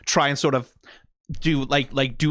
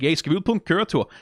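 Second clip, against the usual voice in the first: higher-pitched mock Danish soldier; imitating an excited Danish-accented soldier; 9 semitones higher than usual. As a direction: call it out like a Danish game voice actor. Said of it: "Danish voice actors in, and they did".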